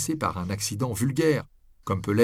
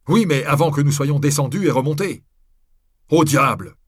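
loud, raised, agitated outburst; shouting; 6 semitones higher, 8 dB louder than usual. A narrator's reading. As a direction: shout back in frustration. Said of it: "cria-t-il alors".

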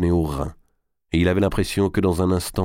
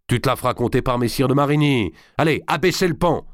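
high, impatient command; shouted order; 8 semitones higher than usual. A narrator's reading. D'Artois leaving, ordering Berzumé about, shouting the command. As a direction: dismiss him, then call out the order higher still. Enraged lord ordering the bridge lowered.

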